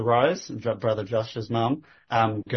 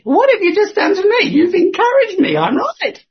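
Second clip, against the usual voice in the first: high, loud triumphant burst; excited; 8 dB louder than usual. Retold or imitated. Telling himself she only proved him right, excited and loud. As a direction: crow it, lifted and loud, as if vindicated. Triumphant retort in retold thought.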